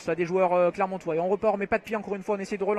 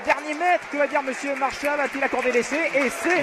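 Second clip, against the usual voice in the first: excited try call; 6 semitones higher than usual. Real, not acted. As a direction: build up to the try. Live try build-up and call.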